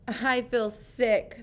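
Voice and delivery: slow, lightly weak; weak complaint. A narrator's reading. Sarah telling her father she feels sick, slow and weak. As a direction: say it feebly, dragging. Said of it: "said Sarah weakly".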